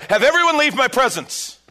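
loud, high anguished cry; desperate ruler clearing the room; cried out. Acted. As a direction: call it out at full voice, breaking with emotion. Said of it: "He cried out".

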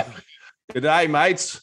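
hammed-up Aussie hello; mock Australian accent. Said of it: "That was wild".

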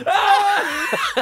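very high shouted shriek; imitated scream. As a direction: mimic a friend's burnt-hand scream. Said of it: "you look at me and you go".

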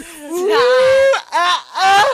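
high-pitched whoop ending in loud yelps; imitated audience squeal. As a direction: mimic the Cape Town woman's squeal. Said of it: "I always hear them go like".

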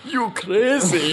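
put-on high, slow, drawn-out South African accent. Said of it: "That was the South African accent, folks".

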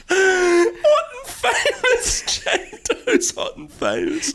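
high, slow words through real laughter; laughing. Real, not acted. Host amazed by the script's line, helpless laughter. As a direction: crack up while repeating the line.

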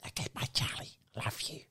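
soft whispered goodbye; whispering goodbye to brother backstage. Pretend. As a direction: hush it, a secret farewell.

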